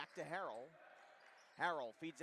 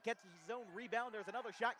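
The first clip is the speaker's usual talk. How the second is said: auctioneer; 6 semitones higher, 4 dB louder than usual. Real, not acted. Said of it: "I was sounding like an auctioneer".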